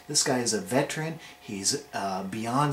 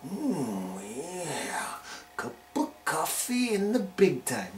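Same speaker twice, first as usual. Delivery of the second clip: whispered, higher wrestler impression; 4 semitones higher, 3 dB quieter, slower than usual. Wrestling vlogger impersonates a wrestler, whispered and higher. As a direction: breathe it like the wrestler on the mic. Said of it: "How about this guy?".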